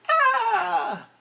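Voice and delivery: high sudden falling yelp; falling cry. Acted. Giant crashing to the ground, crying out. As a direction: cry out as he topples.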